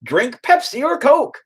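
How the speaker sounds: put-on drawn-out goofy parody accent; mock Americanized Japanese. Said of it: "They're not gonna just be Americanized".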